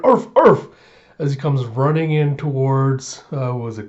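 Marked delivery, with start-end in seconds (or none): 0.0-0.7 loud, high-pitched arfing; dog bark. Acted. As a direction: imitate an excited dog barking. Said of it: "And you hear".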